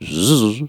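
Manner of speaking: imitated short wing buzz; quick buzzing zzz; buzzing hummingbird. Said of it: "I suddenly heard this".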